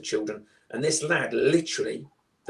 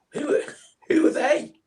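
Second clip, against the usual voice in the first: put-on high, breathless child voice, much higher; scared little kid; 5 dB louder than usual. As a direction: voice a frightened ten-year-old asking.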